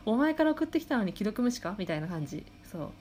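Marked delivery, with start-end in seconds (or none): 0.1-1.8 higher, indignant imitated comeback; huffy mock retort. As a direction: play it as an exasperated retort. Retorting to ignoring friend.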